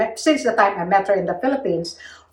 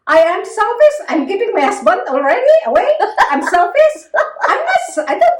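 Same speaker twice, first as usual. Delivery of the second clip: higher, louder outraged "selfish?"; indignant disbelief; 10 semitones higher, 5 dB louder than usual. Real, not acted. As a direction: throw the word back in disbelief. Indignant at being called selfish.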